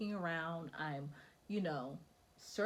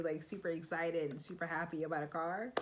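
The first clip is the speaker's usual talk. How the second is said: ordinary talk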